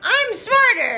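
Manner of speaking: high, loud defiant shout; high angry yell. Retold or imitated. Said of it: "he's just like".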